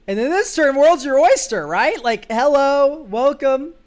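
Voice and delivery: high, loud, gleeful build-up; "hello, welcome" drawn out with glee; excited. Real, not acted. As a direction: celebrate the perfect turn. Cheering a dream turn.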